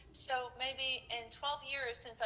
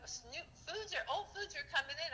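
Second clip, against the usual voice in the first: normal talking voice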